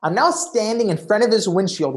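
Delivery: ordinary talk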